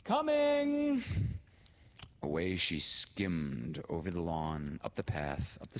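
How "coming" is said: high, loud sing-out reply; loud answering holler, pitched high; calling back. A narrator's reading. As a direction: call back across the garden. Laura answers the call from afar.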